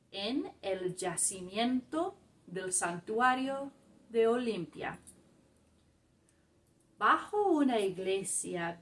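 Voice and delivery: slow, deliberate Spanish reading with pauses between phrases; careful teacher read-aloud. Shown as a demonstration. Teacher reading a Spanish article to a learning group, slow careful read-aloud.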